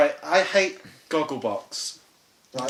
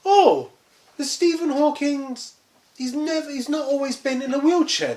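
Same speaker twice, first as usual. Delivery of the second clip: high, airy mock-clueless girl; ditzy girl voice; 9 semitones higher than usual. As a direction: play a clueless young woman. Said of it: "these girls go".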